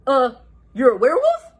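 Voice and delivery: hesitant, unsure question; timid Shaggy. Acted. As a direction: mumble the excuse. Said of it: "Shaggy said timidly".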